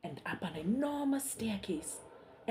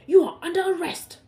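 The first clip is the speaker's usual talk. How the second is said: higher, loud barked order; loud guard's command; stern soldier; 10 semitones higher, 13 dB louder than usual. Acted. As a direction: announce the arrest like a guard. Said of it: "said the soldier".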